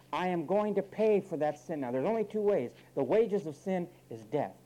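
high, loud fiery sermon build; preaching at full force. Acted. Preacher driving the point home to the congregation, loud and fiery. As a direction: preach it hard, voice climbing and ringing.

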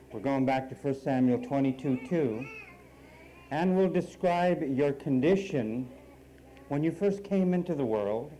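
loud, slow sermon delivery; preaching at full force. Acted. Preacher calling the congregation back to the scripture, loud and slow. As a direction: announce the text with a ringing, driving voice.

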